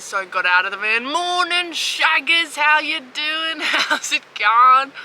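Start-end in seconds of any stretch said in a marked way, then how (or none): 1.0-4.9 high, slow friendly laddish bloke; blokey voice. Retold or imitated. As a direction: greet them like one of the lads. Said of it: "Let's put on my blokey voice".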